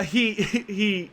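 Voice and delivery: ordinary talk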